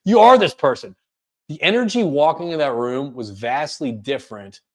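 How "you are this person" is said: rallying, emphatic self-encouragement; self pep talk. Retold or imitated. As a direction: talk yourself into it, firmer each line. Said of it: "changed how I talked to myself".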